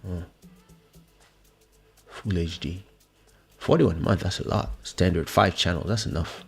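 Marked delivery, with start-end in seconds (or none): none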